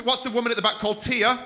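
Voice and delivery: ordinary talk, nothing marked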